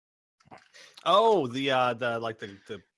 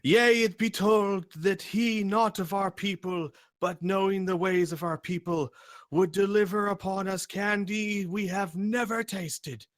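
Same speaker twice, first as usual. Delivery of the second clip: loud proclaiming shout; 10 semitones higher, 5 dB louder than usual. Acted. Counter guy shouting the prophecy to gathering crowd, loud. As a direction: shout it like a town crier reciting scripture. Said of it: "he shouts loudly".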